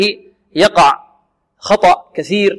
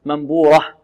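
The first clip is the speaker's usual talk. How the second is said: ordinary talk, nothing marked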